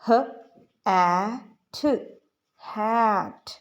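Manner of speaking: "ह, ए, ठ" sounded apart, then joined into "हैट"; teacher sounding out "हैट"; blending demo. Shown as a demonstration. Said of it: "मैं इसको आपको पढ़ाती हूँ".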